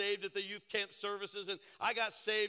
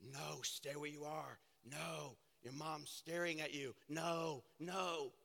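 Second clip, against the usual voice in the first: softer, hushed tempting voice; the enemy's voice; 5 semitones lower, 8 dB quieter, slower than usual. Retold or imitated. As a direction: whisper like the enemy holding you back. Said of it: "I'm hearing the enemy".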